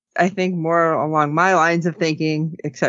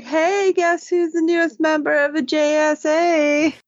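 high-pitched, hammed-up happy singsong; happy announcement; 9 semitones higher than usual. Mike announces he is the newest member, imitated happy excitement. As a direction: imitate a gleeful kid breaking big news. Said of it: "He is all happy".

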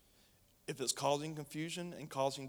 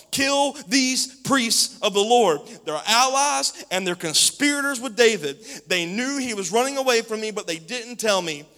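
higher, much louder command; shouted order; 8 semitones higher, 14 dB louder than usual. Acted. Saul orders his bodyguards to kill, shouting. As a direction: order the killing with fury. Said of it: "He ordered his bodyguards".

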